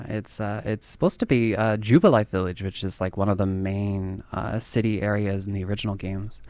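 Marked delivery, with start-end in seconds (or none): none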